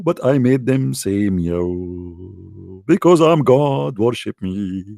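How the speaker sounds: slow, low, pompous boasting; mock God voice. Mocking the Quran's God to a caller, slow pompous voice. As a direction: drag it out like a self-important deity. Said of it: "I can say now, like, I".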